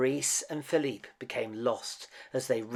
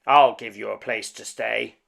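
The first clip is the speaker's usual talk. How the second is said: loud angry shout; louder furious shout; 11 dB louder than usual. A narrator's reading. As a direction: shout it back at him. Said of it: "shouted the beast".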